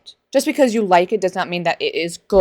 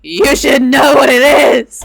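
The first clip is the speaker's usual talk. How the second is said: higher, louder, slow "You should know what it is"; exasperated; 6 semitones higher, 9 dB louder, slower than usual. Shown as a demonstration. Annoyed she must explain again, exasperated. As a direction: stretch out "what" in loud exasperation. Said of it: "repeated, exasperated".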